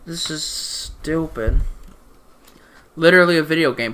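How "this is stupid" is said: slow, unimpressed groan of a verdict; deadpan disdain. Retold or imitated. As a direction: drag it out, utterly done with it.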